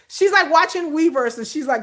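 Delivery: ordinary talk, nothing marked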